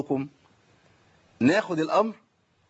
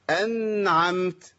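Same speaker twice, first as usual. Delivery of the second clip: نطق بطيء ممدود؛ مدّ "أنعمت" ببطء شديد لإظهار النون الساكنة؛ قل "أنعمت" حرفًا حرفًا ببطء؛ نطق تعليمي بطيء; much slower than usual. Shown as a demonstration. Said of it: "بصوا كده هتلاقوا إيه؟".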